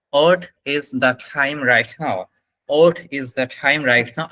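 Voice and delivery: slow model question, "What" as "ot"; slowly, "What" without its w, like "ot". Shown as a demonstration. Teacher modelling the time question, slow British-style "What". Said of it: "এরকম উচ্চারণ হবে".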